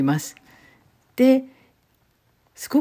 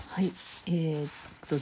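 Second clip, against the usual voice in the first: ordinary delivery, nothing marked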